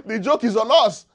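real laughter in a sermon; laughing. Real, not acted. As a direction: laugh at yourself with the congregation. Preacher laughing at the congregation.

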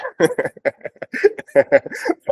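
a short high laugh at the title; amused laugh. Real, not acted. Pastor laughing at members using pharmacist as a title. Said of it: "laughing at pharmacist title".

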